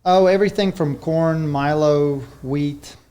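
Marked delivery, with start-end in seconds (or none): none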